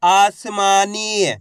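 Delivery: आसमानी very slow, long vowels held; syllables blended slowly. Shown as a demonstration. Teacher answering what the letters make, आसमानी drawn out. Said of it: "तो बताइए मिलके क्या बनेगा".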